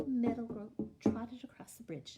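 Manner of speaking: ordinary delivery, nothing marked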